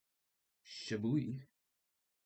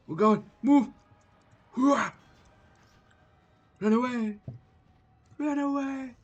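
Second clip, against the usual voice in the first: high, loud frantic yelling; panicked gamer shout; 10 semitones higher, 6 dB louder than usual. Real, not acted. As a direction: shout in a scramble to flee. Panicked fighting enemies.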